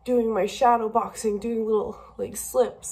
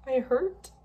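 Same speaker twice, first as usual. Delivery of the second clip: tearful; 5 semitones higher than usual. Real, not acted. Still crying.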